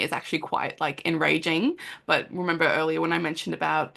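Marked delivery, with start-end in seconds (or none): none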